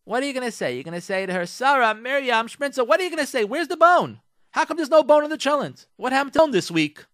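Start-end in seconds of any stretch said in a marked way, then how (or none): 1.4-7.1 high, loud mock complaint; indignant husband. Husband to wife about the missing bone, indignant. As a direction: demand it loudly, pitched way up.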